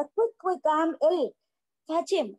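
high, urgent, frantic calling; mild hammed-up hysterics; hysterical plea. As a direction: beg like she is falling apart. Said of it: "hysterics means madness में crazy होकर चिल्लाना".

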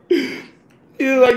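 ordinary talk, nothing marked

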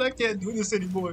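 high-pitched imitation of giving up; mock despair. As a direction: put on a high, defeated voice. Voicing the man giving up.

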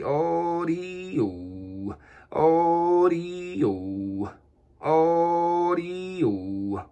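long stretched radio call; drawn-out call into the mic; over the radio. Real, not acted.

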